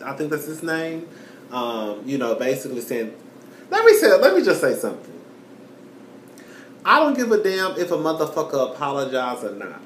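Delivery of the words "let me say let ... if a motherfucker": heated outburst. Real, not acted. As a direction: jump in hot and fast. Cutting in to rant.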